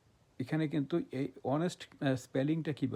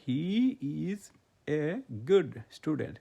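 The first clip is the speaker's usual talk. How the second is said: slow example; 4 dB louder, much slower than usual. Shown as a demonstration. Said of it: "example sentence read to class".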